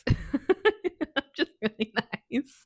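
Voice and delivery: laughing through the words at herself. Real, not acted. Laughing at herself to viewers about staying. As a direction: giggle it out, amused at herself.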